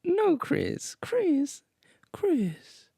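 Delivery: very high falsetto, sinking to a whisper. Retold or imitated.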